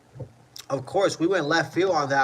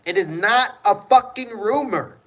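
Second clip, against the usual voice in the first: louder, higher, hammering it home; exasperated yell; 6 semitones higher, 7 dB louder than usual. Real, not acted. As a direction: snap it at the chat, fed up. Insisting injury news is true.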